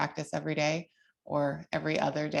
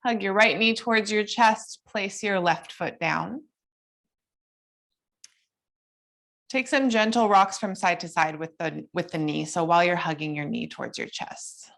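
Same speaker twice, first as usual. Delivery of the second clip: higher, gentle guiding cues; yoga instructor voice; 4 semitones higher than usual. Acted. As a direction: lead the class through poses calmly.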